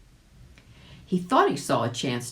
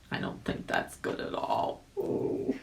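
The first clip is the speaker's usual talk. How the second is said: much lower, soft whisper; whispered aside; 6 dB quieter than usual. Acted. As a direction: breathe it secretly. Rabbit's hushed aside.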